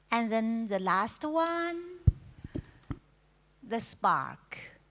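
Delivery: ordinary talk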